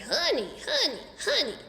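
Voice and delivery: high squawky bird echo; parrot repeating. A narrator's reading. Uncle Feather copying the word, squawky high bird. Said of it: "Uncle Feather started".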